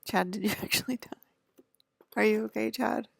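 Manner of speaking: laughing call to an ex who may be dead. Real, not acted. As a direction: giggle while calling to the ex. Calling out to a dead ex.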